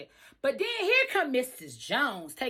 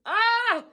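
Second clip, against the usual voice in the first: crying "oh" breaking high; sob; 11 semitones higher, 5 dB louder than usual. Real, not acted. Overwhelmed by the Joneses, crying. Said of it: "bust out crying".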